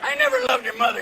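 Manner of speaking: high, loud slurred confession; tipsy dad. Acted. Drunk dad at Thanksgiving to his child, tipsy.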